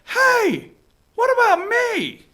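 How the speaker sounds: high, pleading hey what about me; high mock plea of a forgotten candidate; whiny mock plea. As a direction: play a candidate left out. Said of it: "He's like".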